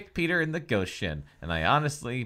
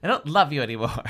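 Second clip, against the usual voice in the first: laughing breakup line; 4 semitones higher, 8 dB louder than usual. Acted. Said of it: "she's dumping her ghost boyfriend".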